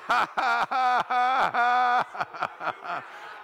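long run of rapid staccato ha-ha; rapid-fire declamatory laughter; deliberate faith laugh. Shown as a demonstration. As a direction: keep laughing on purpose till the breath runs out. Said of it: "laugh for a few minutes".

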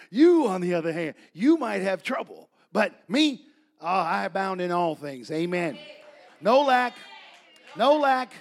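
loud, emphatic pulpit boast; rousing preacher's shout; triumphant preaching. Real, not acted. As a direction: proclaim it at full force to the room. Preaching climax after joking retort.

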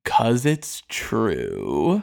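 low, very slow menace; sinister Pope, lightly voiced; evil grin. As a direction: lay it down slowly with a smug evil grin. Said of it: "flashes this evil fucking omen grin".

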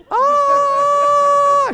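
high, loud, drawn-out scream; screaming bloody murder. Retold or imitated. Dragged woman screaming bloody murder as she runs. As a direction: imitate a woman's long piercing scream. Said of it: "She's screaming bloody murder".

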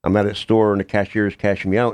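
ordinary talk, nothing marked

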